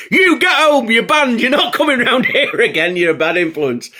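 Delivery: high, loud mock telling-off; hammed-up furious mum; angry scolding. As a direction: banish him like an outraged mum. Mum banishes narrator.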